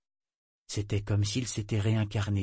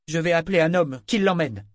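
haute et bien plus forte, exaspérée; s'exclamer, à bout de patience; exclamation agacée; 8 semitones higher, 7 dB louder than usual. A narrator's reading. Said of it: "Etta s'exclama agacée". (exclamation fâchée)

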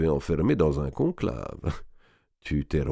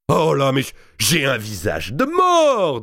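slow, loud mock dismay, higher; boisterous lord; 7 semitones higher, 5 dB louder, slower than usual. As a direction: groan at his own face. Robert d'Artois at the mirror. (un seigneur qui se plaint à grand bruit)

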